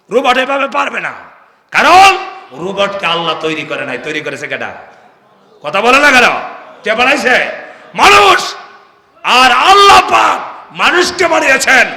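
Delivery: loud, high preaching call; full-force sermon shout; fiery preaching. Acted. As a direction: preach it out to the crowd.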